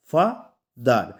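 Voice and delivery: teacher's two-beat ফা... দার; split into syllables. Shown as a demonstration. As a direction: sound out ফা and দার one at a time. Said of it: "এটাতে আমাদের দুটা syllable".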